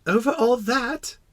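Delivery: put-on high, slow child's question; amazed little prince. Little prince questioning the king's vast realm, amazed. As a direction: ask it in small-voiced wonder. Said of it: "asked the little prince".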